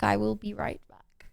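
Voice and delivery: slow, soft whispered repeat. Shown as a demonstration. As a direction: whisper it as you leave.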